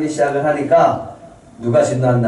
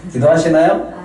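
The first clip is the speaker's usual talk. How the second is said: raised, more insistent question; prompting the congregation; 3 semitones higher, 3 dB louder than usual. Real, not acted. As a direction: call out for a bigger amen. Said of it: "아멘 소리가 적네".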